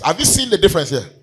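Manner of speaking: fervent sermon, rising to shouts; preaching. Acted. Preacher urging the church toward gratitude, fervent. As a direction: preach it out to the congregation.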